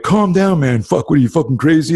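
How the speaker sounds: high, loud panicked protest, imitating the guys; mock freaked-out yelp as they back away; imitating two guys, rattled after being threatened, high and loud; alarmed backing off. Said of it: "they looked at me and they went".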